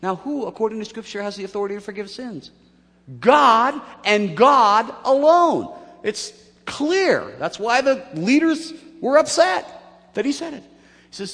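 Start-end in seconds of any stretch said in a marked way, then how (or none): 3.2-10.6 loud, high, ringing declaration; emphatic proclamation. Real, not acted. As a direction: proclaim it slowly and forcefully. Preacher emphatically answers own question.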